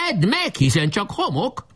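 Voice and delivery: high-pitched taunting call; shout. A narrator's reading. Defender taunting attackers from the fort, shouting. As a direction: jeer it out high, like a battle cry. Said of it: "kiáltotta".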